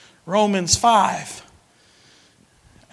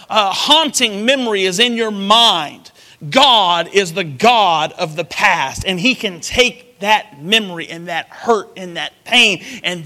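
preaching; 5 dB louder than usual. Acted. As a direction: preach it with rising force. Preaching with force.

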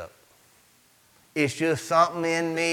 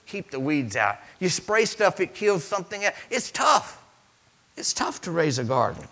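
ordinary delivery

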